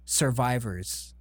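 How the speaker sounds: slow, deadpan reveal. Real, not acted. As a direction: drop the word slowly for effect.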